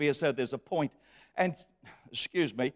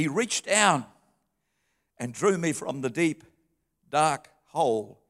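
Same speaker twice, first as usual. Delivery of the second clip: normal talking voice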